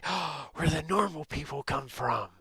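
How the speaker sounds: loud, high mock-awed rejoicing; elated cheer. Reacting to hearing she is from Canada, elated cheer. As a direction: gasp it, then cheer loudly up high. Acts out joy at meeting Canadian.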